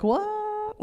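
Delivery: very high, soft, drawn-out question; mock surprise. Comic replays hearing her date ask to shower, high mock surprise. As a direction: squeak it in puzzled disbelief.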